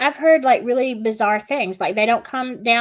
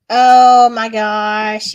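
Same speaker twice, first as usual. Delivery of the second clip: slow, stretched, louder amazed gasp; drawn-out oh my gosh; 8 dB louder, much slower than usual. Real, not acted. Remembering the documentary, a slow loud amazed oh my gosh. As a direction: let it drag out in disbelief.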